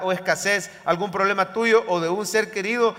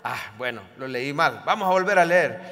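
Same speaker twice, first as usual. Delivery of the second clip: normal talking voice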